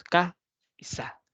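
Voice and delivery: casa split as ka, pause, sa; teacher splits casa into ka and sa; syllables spelled out. Shown as a demonstration. Teacher to students splitting casa into syllables, slow and separated. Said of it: "tiene dos emisiones de voz".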